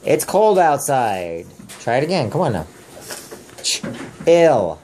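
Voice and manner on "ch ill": "Ch-, ill" split into two parts with a pause; sounded out. Shown as a demonstration. Parent helps child read the word, splitting "Ch-, ill". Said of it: "Just look at the word".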